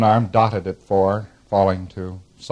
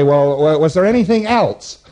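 louder, higher acted question; auditor's probing question; 5 semitones higher, 6 dB louder than usual. Lecturer playing the auditor prompting a patient, raised and probing. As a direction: project the question, pitch climbing. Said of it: "And you say".

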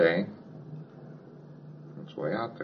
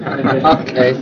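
laughing; 5 semitones higher, 8 dB louder than usual. Real, not acted. Laughs after shared joke.

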